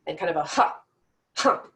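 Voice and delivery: sharp pushed "ha" from the diaphragm; breath bursts. Shown as a demonstration. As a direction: force the air out in short hard bursts. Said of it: "force the air out in hard bursts".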